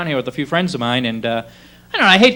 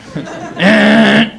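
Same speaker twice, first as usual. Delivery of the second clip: car horn honk; 2 semitones higher than usual. Retold or imitated. Driver behind a stalled car beeps at him, blaring horn noise. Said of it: "by beeping his horn".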